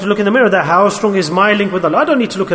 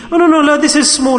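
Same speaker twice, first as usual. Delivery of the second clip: raised voice, brushing off the sin; dismissive excuse; 8 semitones higher than usual. Retold or imitated. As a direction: wave it away as nothing, voice pitched up. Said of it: "like I said to you".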